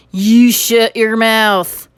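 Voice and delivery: slow, loud Texas cowboy shout. A narrator's reading. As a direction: bark it at the boy, slow and loud. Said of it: "shouted the little man".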